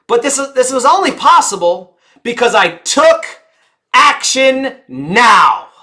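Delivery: rallying call to act now; shout. Shown as a demonstration. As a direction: hammer the urgency home.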